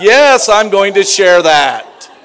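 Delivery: loud, drawn-out, defiant yes; emphatic retold reply. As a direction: belt out the comeback slowly and proudly.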